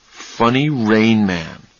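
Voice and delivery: slow, wondering recall of "Funny Rain Man"; slow musing. Acted. Charlie slowly recalling the phrase.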